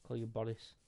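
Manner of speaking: ordinary delivery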